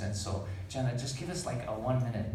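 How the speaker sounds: normal talking voice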